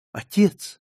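high, anxious call. A narrator's reading. Margarita calling her senseless father, calling out. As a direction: call to someone who doesn't respond. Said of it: "окликнула его Маргарита".

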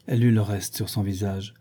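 usual speaking voice

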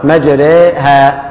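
"مجريها" very slowly, the ra vowel tilted toward e; slow teaching model of "مجريها"; drawn-out imala. Shown as a demonstration. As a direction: stretch "مجريها" syllable by syllable with a leaning ra. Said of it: "نفس الطريقة دي".

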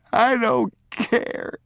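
high, drawn-out wail; sobbing child. A narrator's reading. Boy repeating his defiance to father, drawn-out sob. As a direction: sob it out, stretching the words.

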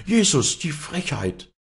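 high-pitched crowd outcry; outraged cry. A narrator's reading. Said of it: "schrien alle wild durcheinander".

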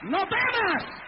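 retold prophecy, rising into a long shout. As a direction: imitate a prophetess, building to a high, drawn-out cry. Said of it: "sale corriendo en profecía y me dice".

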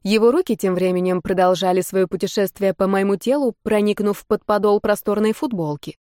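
usual speaking voice

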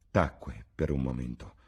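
plain reading voice

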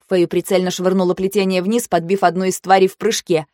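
ordinary delivery

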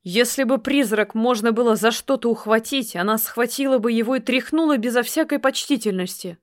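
plain reading voice

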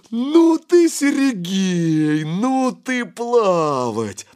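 sputtering shout through spray, high and loud; shower spray in face. A narrator's reading. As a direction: spit water out while you yell. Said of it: "фыркая и отплёвываясь, крикнул".

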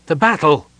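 insist, losing patience; impatient. A narrator's reading. Sylvan presses the puzzled elf, impatiently. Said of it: "Sylvan repeated impatiently".

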